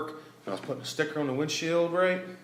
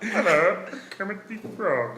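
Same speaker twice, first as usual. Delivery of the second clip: higher puppet-frog attempt; Kermit the Frog; 7 semitones higher, slower than usual. Shown as a demonstration. Friend joins the impression, attempted Kermit voice. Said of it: "See if I can do it".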